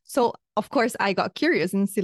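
ordinary talk

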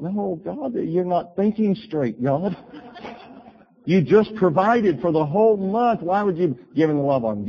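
hammed-up objection to God; protesting to God. Acting out his argument with God.

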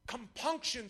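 ordinary talk, nothing marked

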